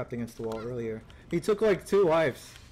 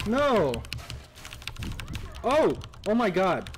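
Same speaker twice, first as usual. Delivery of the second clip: dismayed; 5 semitones higher, slower than usual. Real, not acted. Reacting to game loss.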